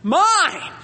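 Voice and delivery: put-on high, loud, drawn-out child's "Mine."; possessive child. Preacher imitating a small child grabbing a toy, high and loud. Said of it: "From a child, it sounds like".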